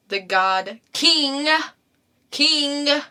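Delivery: ordinary delivery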